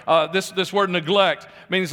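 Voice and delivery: ordinary delivery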